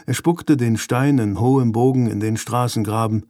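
plain reading voice

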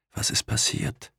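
soft, voiceless whisper. A narrator's reading. Patient waking from anaesthesia asks Pamela, whispering. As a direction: whisper it faintly, barely awake. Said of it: "flüsterte er".

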